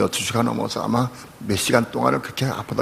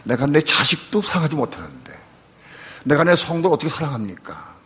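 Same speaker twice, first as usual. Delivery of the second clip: pleading prayer rising higher and louder; anguished prayer; 5 semitones higher, 3 dB louder than usual. Retold or imitated. Pastor praying after yelling at his son, anguished plea. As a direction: cry out to God in anguish. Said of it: "나 기도했어요".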